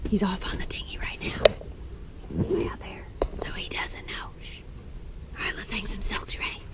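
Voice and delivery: hushed secretive whisper. Pretend. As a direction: whisper so he won't hear. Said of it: "Why are we whispering?".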